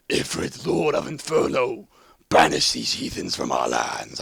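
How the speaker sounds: low, gnarly lizard growl dropping deep; deep reptilian voice. Retold or imitated. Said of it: "this deep reptilian voice".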